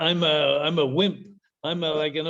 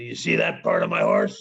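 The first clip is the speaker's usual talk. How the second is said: much lower, gruff mock-serious voice; Mountie deadpan. Mountie pointing out the horse's head to the driver, deep and gruff. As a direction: drop the voice deep and stern. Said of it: "I look at him and I say".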